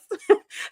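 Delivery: high, short chuckle; real giggle after a jab; amused laugh. Real, not acted. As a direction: laugh at your own dig. Laughs at own dig.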